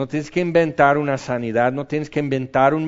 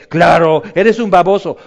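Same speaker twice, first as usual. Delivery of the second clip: louder scornful jab; loud taunting put-down; mocking insult; 6 dB louder than usual. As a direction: jeer at him for being a fool. Imagined voice insults the speaker.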